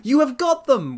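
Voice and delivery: high, loud astonished cry; excited cry. Acted. Listeners exclaiming to Holmes, high excited cry. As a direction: burst out in amazement. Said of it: "we cried".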